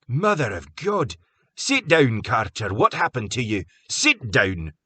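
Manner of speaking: alarmed. A narrator's reading. Graham alarmed at wound.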